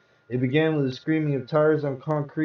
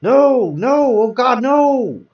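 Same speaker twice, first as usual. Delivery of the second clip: high, loud, drawn-out scream of grief; anguished scream; 10 semitones higher, 10 dB louder, much slower than usual. Acted. Husband screaming as his dead wife is covered. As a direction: scream it as the sheet covers her face. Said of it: "I screamed".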